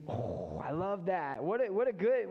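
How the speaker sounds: ordinary talk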